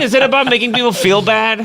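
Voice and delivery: ordinary talk, nothing marked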